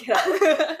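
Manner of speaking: laughing. Retold or imitated. Playing the mom, laughing.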